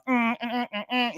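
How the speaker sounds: high hummed notes; short high hums imitating the funeral ceremony; imitated ceremony sound. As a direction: hum a mock ceremonial sound.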